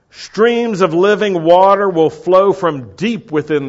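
ringing quote that eases toward the end; loud proclamation. Acted. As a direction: proclaim it to the whole crowd. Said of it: "He said things loudly".

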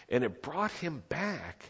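normal talking voice

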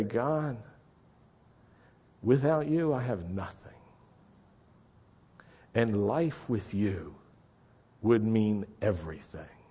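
hushed surrender, sinking at the end; humble prayer. Acted. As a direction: pray it quietly, phrase by phrase.

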